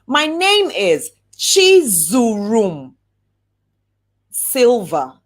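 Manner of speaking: slow, proud, every syllable of "Chizurum" given its room; slow clear introduction. Shown as a demonstration. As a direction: say "Chizurum" slowly, letting each syllable land. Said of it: "take your time and say".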